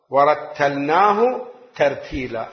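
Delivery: drawn-out Quranic recitation; slow recitation. Shown as a demonstration. Preacher showing the congregation unhurried recitation. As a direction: recite it slowly, word by word. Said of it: "القراءة المتأنية".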